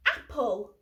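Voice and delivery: ordinary delivery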